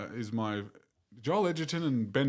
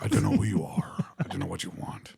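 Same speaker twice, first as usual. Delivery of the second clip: low Northern Irish menace on the phone; threatening film-hero voice, getting lower; Liam Neeson; 4 semitones lower than usual. Acted. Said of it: "It's Liam Neeson".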